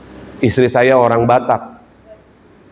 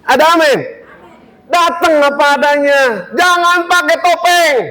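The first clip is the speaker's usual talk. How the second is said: normal talking voice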